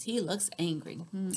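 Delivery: usual speaking voice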